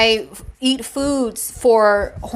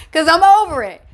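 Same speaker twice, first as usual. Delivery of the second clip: laughing it off; 7 semitones higher than usual. Real, not acted. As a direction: laugh away the tears and insist on being over it. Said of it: "And I'm not gonna cry".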